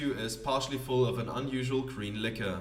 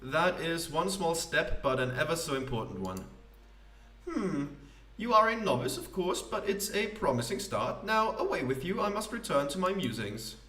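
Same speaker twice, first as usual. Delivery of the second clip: higher, grand theatrical poet voice; pompous poet; 5 semitones higher than usual. Acted. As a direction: play a self-important wandering poet. Poet judges the robot's poem.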